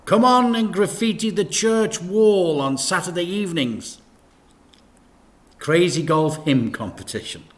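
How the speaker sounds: plain reading voice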